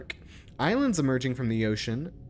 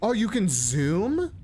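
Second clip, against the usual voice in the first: surprised discovery; 7 semitones higher than usual. Real, not acted. Discovering zoom in the game.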